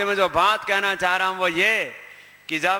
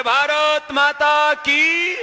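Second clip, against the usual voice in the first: high, loud, drawn-out slogan call; stretched-out patriotic chant; rallying slogan; 5 semitones higher, 5 dB louder, much slower than usual. Real, not acted. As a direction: shout it out for the crowd to answer. Leads crowd in slogan.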